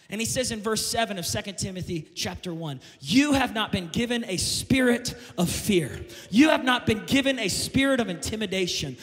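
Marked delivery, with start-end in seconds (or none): 3.0-9.0 loud, slow, ringing scripture proclamation; preaching at full force. Acted. Pastor proclaiming scripture to the church, full-force preaching. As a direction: declare it over the crowd, building louder.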